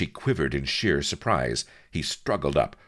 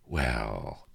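low, purring drawl; purred; 5 semitones lower, 3 dB quieter than usual. A narrator's reading. Lady sizing up her visitor, purring. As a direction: purr it out, low. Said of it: "purred the lady".

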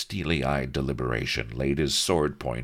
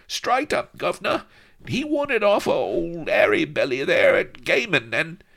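high falsetto little informer; babbling Goliath; 7 dB louder than usual. A narrator's reading. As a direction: spill it eagerly in a squeaky Cockney-style voice. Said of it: "babbled Goliath".

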